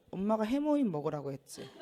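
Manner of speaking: soft, icy retold reproach; cool, quiet I-told-you-so; cold mother. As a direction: imitate mom answering without sympathy. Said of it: "엄마가 정말 싸늘하게".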